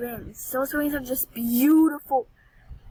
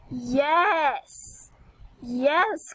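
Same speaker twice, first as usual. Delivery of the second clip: ordinary talk, nothing marked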